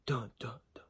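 气声耳语的戏剧音效; 悄声模仿悬疑配乐; whispered dun dun. Acted. Mock dramatic sting, whispered.

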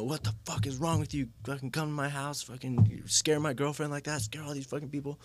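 high, hammed-up angry tirade; furious attacker. Boyfriend scolding him for scaring his girlfriend, furious. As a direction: rant like a thug who caught you.

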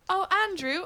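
high, prim British call; very proper British. Pretend. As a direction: put on a proper British lady. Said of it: "talk very proper like this".

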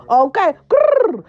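high, slow mock growl; playful growl. Vlogger growling playfully to hype the power.

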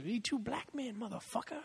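high, tough Samuel L. Jackson growl with attitude. Retold or imitated. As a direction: play Samuel L. Jackson telling someone off. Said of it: "that was my Samuel L. Jackson voice".

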